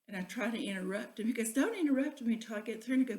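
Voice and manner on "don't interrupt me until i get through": louder, fast, snapping retort; mock stern scolding. Husband scolding his wife for interrupting, fast and loud. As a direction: cut her off sharply, fast and loud. Pat scolds during lecture.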